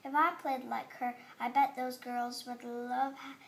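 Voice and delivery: plain reading voice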